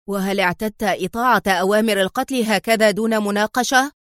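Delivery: ordinary delivery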